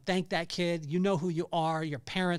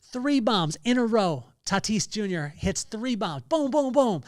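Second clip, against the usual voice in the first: higher and louder, hyped replay of three home runs; excited; 6 semitones higher, 5 dB louder than usual. Real, not acted. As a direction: sell every bomb like a thrilled fan. Retelling three home runs in row.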